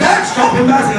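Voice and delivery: high-pitched retold plea; embarrassed son. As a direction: urgently try to hush dad. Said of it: "I'm getting embarrassed".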